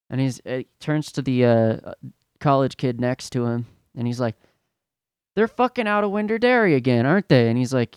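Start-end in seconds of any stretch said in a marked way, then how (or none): 5.3-7.5 high, fast mock complaint; irritated customer. Suited customer to the college kid next to him, peeved. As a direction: imitate a peeved shopper griping in a raised pitch.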